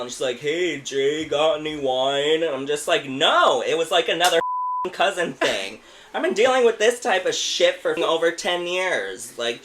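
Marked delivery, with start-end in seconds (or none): 0.4-2.4 mock drunk, raised and drawn out; imitated drunk. Drunk neighbor at the door asking for wine, mock drunk. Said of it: "drunk as hell". 3.0-9.4 loud, raised, fed-up rant; exasperated. Retold or imitated. Man fed up after ten years of this, exasperated.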